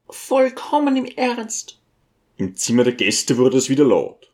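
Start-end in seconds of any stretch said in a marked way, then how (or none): 0.1-1.8 high, slow lady's voice, falling close; firm lady. A narrator's reading. Lady answers the teacher's doubt, high and firm. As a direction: confirm it slowly in a high character voice.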